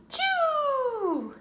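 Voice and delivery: high loud gasp then a huge sneeze; big sneeze. Acted. Reader acting the bear's sneeze for children, gasp then loud ah-choo.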